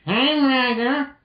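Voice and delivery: high, slow, put-on girlish call; Roll calling out. Reading Roll's line to Magda, high slow call. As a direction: sing out her name sweetly.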